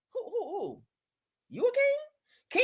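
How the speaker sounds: high, slow disbelieving squeal; mock him with a shocked high slow question; you, a king? said in high mocking disbelief; incredulous. Real, not acted. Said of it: "mocking disbelief".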